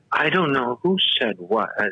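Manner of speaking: usual speaking voice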